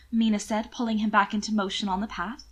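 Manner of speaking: ordinary delivery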